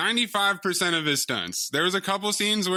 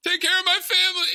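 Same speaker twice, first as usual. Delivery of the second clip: high, fast dying plea; hammed-up dying cop; 12 semitones higher, 4 dB louder, much faster than usual. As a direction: overplay the movie death scene. Mocking cliched dying cop lines.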